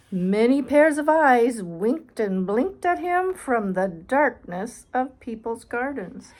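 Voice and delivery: slow, drawn-out storybook reading; spooky bedtime narration. Acted. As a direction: stretch out the eerie night. Reads picture book aloud.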